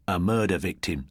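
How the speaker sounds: ordinary delivery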